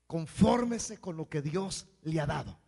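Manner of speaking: ordinary delivery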